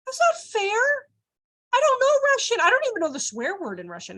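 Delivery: high, whiny mock complaint; high-pitched mock whine; whiny protest. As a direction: play the upset learner. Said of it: "how many people are like".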